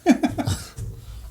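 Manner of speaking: airy giggle up high; high breathy laugh. Real, not acted. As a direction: let out a squeaky laugh. Laughing at own mistake.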